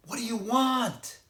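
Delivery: piercing high scream at full force; terrified scream. A narrator's reading. Girl screaming at a stranger outside, terrified. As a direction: scream it to wake the whole house. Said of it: "she screamed as loud as she could".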